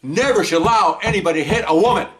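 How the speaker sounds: high, indignant imitation; acted outburst. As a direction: hammer it out like she's banging the table. Imitating applicant's outburst at table.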